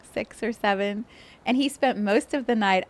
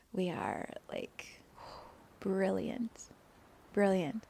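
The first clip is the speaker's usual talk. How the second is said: softer, whispered brilliant; hushed awe; 7 dB quieter, slower than usual. Real, not acted. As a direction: let brilliant fade into whisper. Soft awed repetition.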